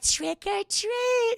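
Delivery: very high, drawn-out kiddie squeal; singsong imp voice, stretched out; little trick-or-treaters. Retold or imitated. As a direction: imitate creepy little kids, high and slow. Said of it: "these little trick-or-treaters like".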